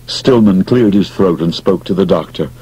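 plain reading voice

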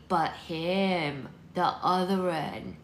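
put-on old woman slowly pressing her point; urgent. A narrator's reading. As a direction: urgently drag out the words. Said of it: "Maud said urgently".

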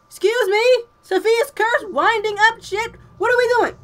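loud, high-pitched disbelieving questions; incredulous outburst. Real, not acted. Player baffled by winding instructions, loud incredulity. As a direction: fire back in outraged disbelief.

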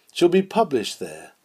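normal talking voice

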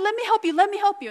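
high, loud eager offer; eager call. Retold or imitated. Running to a stranger, eagerly calling out. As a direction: call out brightly to help. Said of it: "I said".

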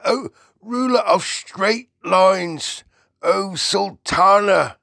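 loud, high, drawn-out acclaim; reverent giant's cry. A narrator's reading. BFG hails the Queen, loud reverent cry. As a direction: cry it out in slow, grand homage. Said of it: "cried the B F G".

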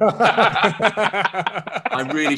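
slow, laughing through the words. Real, not acted. Author laughs at his own story's punchline, slowly. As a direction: chuckle out the regret slowly.

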